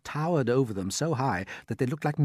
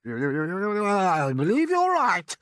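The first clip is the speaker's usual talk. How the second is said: a much higher, louder put-on aunt's voice, excited; Aunt Sponge; 8 semitones higher, 6 dB louder, much slower than usual. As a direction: play the greedy aunt gaping at the peach.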